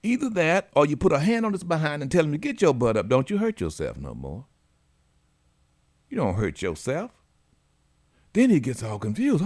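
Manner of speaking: usual speaking voice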